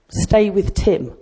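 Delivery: ordinary talk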